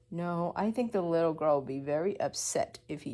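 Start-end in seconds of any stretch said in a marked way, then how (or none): none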